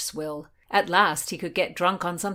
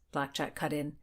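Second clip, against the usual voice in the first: usual speaking voice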